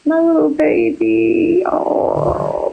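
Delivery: tearful, breaking into a sob over the dog; slow, high and then a voiceless sob; choked-up crying. Real, not acted. Grieving owner crying over her lost chihuahua, tearful.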